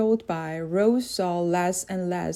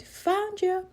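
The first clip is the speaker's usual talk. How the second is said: excited scream; 12 semitones higher than usual. A narrator's reading. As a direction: leap out and scream it.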